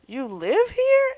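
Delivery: high, drawn-out disbelieving echo; mock incredulous question. Prince learns Francis lives there, high incredulous question.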